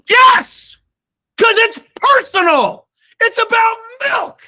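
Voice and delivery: high, loud, fervent cry; excited outburst. Real, not acted. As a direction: burst out with real passion. Passionate outburst in a sales pitch.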